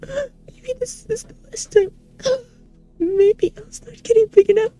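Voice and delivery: high, tearful begging; sobbing plea. Acted. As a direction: beg through sobs. Shrinking again, sobbing.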